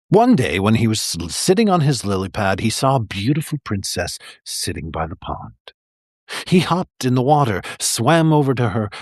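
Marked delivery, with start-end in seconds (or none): none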